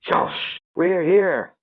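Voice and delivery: high-pitched call to a friend; mild narrated yell. A narrator's reading. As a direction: call out high, without real volume. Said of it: "when he yells".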